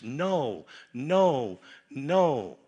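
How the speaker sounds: the preacher drumming in Paul's three "know"s; stressed repetition. Shown as a demonstration. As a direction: pound out "know" three times. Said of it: "He uses the word "know" three times".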